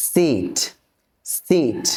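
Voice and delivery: "সিট" with a long ee; long vowel. Shown as a demonstration. Teacher modelling long vowels for learners, drawn-out "সিট". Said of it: "একটু টান দিয়ে উচ্চারণ করতে হবে".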